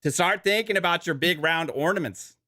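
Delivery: mock Canadian accent reading a sponsor ad. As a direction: sell the razor like a joking Canadian, eh. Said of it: "he's definitely Canadian right now".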